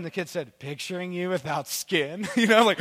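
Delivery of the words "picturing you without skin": slow, eerie child imitation; creepy kid. Child answers mom at night, slow and creepy. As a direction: say it slowly, with deliberate creepiness.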